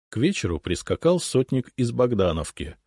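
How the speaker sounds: usual speaking voice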